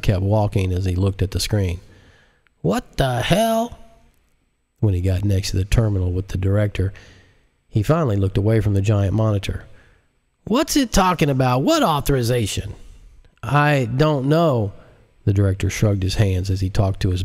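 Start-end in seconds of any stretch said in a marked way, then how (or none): 2.6-3.8 much higher, drawn out; very high slow outburst; baffled president. Acted. President sees screen. 10.4-12.7 higher, pressing; higher, impatient president; demanding president. Acted. 13.4-14.7 slow, higher; a lightly voiced slow director; helpless director. Director answers.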